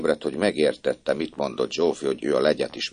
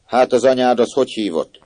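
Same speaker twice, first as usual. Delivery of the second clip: questioning mistress; 7 semitones higher, 6 dB louder than usual. A narrator's reading.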